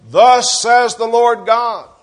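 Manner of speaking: high, loud, slow proclamation; proclaiming. Acted. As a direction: ring it out slowly like a prophet. Preacher voicing God's command.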